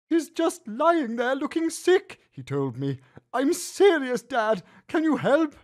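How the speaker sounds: put-on high, worried boy voice; a child pleading with dad; lightly voiced anxious boy; worried son. A narrator's reading. Son worried about sick lizard.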